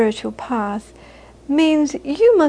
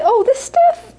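much higher, louder mock mother brushing it off; mother's dismissive reply; 12 semitones higher, 4 dB louder, slower than usual. Scottish mother waving off the Buddhist question, lightly voiced. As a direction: toss it off like a breezy mum. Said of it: "And she'd say".

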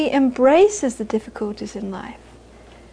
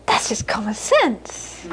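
dismissive; 4 dB quieter, slower than usual. Retold or imitated. Mother telling the audience it is only common sense, dismissive. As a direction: state the obvious, unimpressed. Mother answering about Buddhism.

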